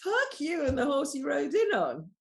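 mock-outraged, pitched well up; scornful retort. Answering the performative inclusive coach, scornful.

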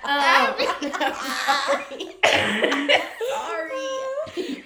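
loud, high laughing apology; real laughing fit, voice going high; cracking up. Real, not acted. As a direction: apologize through uncontrollable laughter.

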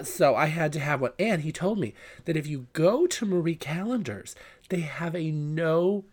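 normal talking voice

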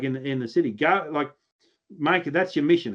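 normal talking voice

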